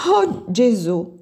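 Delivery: a slow, drawn-out shocked gasp of a cry; scandalized exclamation. A narrator's reading. Eva shocked by the gaudy Viennese dresses, slow scandalized cry. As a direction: let the shock stretch the words out. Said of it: "esclamò Eva scandalizzata".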